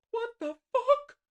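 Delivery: high, slow startled cry; shocked shriek. Real, not acted. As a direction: shriek in disbelief. Shocked by symbol on screen.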